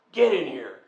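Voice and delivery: loud stern order; loud command. Retold or imitated. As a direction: shout the order at him. Officer ordering him inside.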